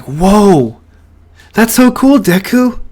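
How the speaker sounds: put-on high, loud, thrilled kid voice; amazed. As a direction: burst out with wide-eyed amazement. Friend amazed by electricity.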